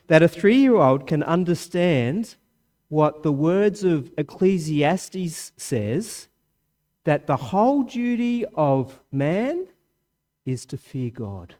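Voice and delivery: normal talking voice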